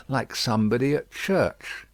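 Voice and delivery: plain reading voice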